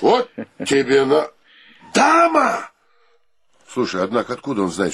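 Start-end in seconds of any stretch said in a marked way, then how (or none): 0.0-2.7 high, astonished exclamation; surprised cry. Acted. Roommate astonished that a lady is coming. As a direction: gasp out the word in amazement.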